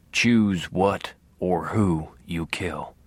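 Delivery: low, very slow ghost voice; Harry. A narrator's reading. Dead father instructing his son, slow ghostly voice. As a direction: intone it low, weighing each word slowly. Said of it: "in his feather-hard ghost voice".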